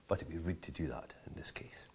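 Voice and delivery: a soft conspiratorial whisper; hushed aside to the viewer. Real, not acted. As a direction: lean in and whisper the joke. Aside about spitting out whisky.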